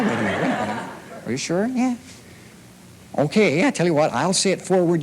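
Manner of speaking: normal talking voice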